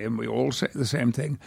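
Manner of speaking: usual speaking voice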